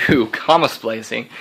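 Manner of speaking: ordinary delivery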